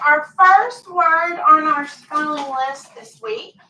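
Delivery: usual speaking voice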